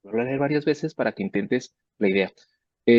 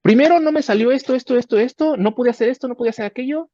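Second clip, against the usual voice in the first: much higher and louder, whiny listing of failures; imitating whiny students; 13 semitones higher, 6 dB louder than usual. Professor imitating students who open with failures, much higher whine. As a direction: whine through every failure like a fretful student. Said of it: "los jóvenes estudiantes que llegan diciendo".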